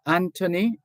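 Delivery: "Anthony" with a plain T in the middle; "Anthony" with the H silent. Shown as a demonstration. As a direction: say "Anthony" as AN-tuh-nee, no th. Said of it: "The H is silent".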